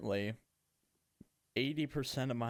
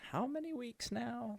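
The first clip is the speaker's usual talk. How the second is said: voice crack; 13 semitones higher than usual. Real, not acted. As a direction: voice cracks up high mid-question. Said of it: "oh, voice crack there".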